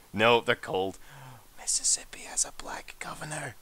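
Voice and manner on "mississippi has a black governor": hushed, secretive whisper; very soft, voiceless aside; conspiratorial whisper. Pretend. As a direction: whisper it like forbidden gossip. Secret, followed by shh don't tell.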